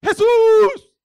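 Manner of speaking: sudden very high, very loud scream of the name; full shout. Acted. Blind man shouting over those who scold him, full shout. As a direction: yell it out long enough to wake the sleepers. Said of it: "más alto el chabón se ponía a gritar".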